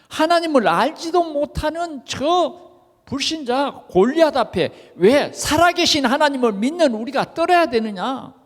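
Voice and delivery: high-pitched, mildly acted indignation; preacher voicing righteous indignation; indignant protest. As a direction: protest as the indignant young David. Acting out David's indignant protest.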